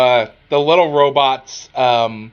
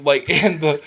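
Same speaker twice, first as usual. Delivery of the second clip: usual speaking voice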